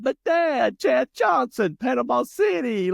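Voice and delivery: put-on high, loud whiny plea like a sobbing child; imitating crying kid. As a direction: wail it like a fifth grader begging his dad. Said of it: "and I'm crying".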